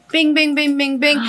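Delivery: ping like alerts coming in; imitated alert pings. Storyteller mimicking messages flooding in for her daughter, imitated pings.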